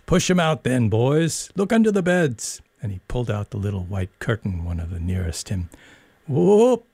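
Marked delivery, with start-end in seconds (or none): 0.1-2.6 higher, bossy schoolboy bully; lightly voiced bully barking orders; bully ordering boys. A narrator's reading. 6.3-6.9 high, loud whoop of triumph; a gleeful hunting cry, much higher; roared whoop. A narrator's reading. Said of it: "he roared".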